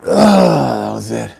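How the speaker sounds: slow, strained, out of breath; gasping it out after a hard set; strained exhale. Real, not acted. Straining at end of heavy set.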